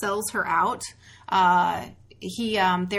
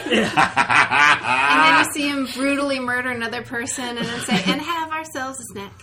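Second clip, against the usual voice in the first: laughing; 2 semitones higher, 8 dB louder than usual. Acted. Imagined Hannibal cartoon, laughing.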